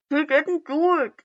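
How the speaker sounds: loud, high indignant outburst. A narrator's reading. Little brother shouting in his brother's defense, indignant. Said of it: "Sasuke interjected indignantly".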